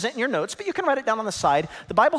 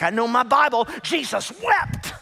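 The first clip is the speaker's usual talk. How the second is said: smug churchgoer; 5 semitones higher, 4 dB louder than usual. Retold or imitated. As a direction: show off like a proud newcomer. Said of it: "You're like".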